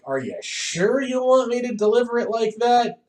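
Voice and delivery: high, questioning actor; doubtful actor voice. Retold or imitated. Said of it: "the voice actors were like".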